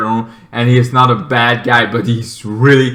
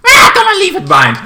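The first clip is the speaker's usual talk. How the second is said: high, fast, louder put-on voice; character quote; 6 dB louder, faster than usual. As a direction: rattle it off in a high voice. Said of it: "he just, like".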